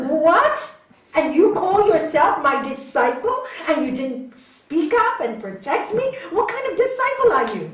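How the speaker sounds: loud, high, indignant master; acted furious rebuke, rising in pitch; outraged scolding. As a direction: explode at your disciple in disbelief. Master scolds the second disciple.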